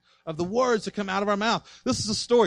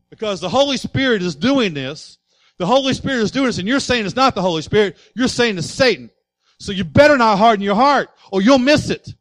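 loud, higher pulpit preaching; fiery preaching; 4 semitones higher, 8 dB louder than usual. Acted. Pastor urging the congregation not to harden hearts, loud preaching.

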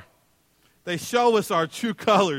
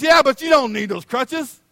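mock argument; 8 semitones higher, 7 dB louder than usual. Bickering in the line.

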